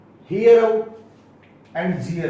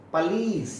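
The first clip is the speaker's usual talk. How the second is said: usual speaking voice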